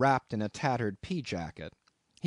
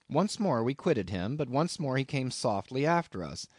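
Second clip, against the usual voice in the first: plain reading voice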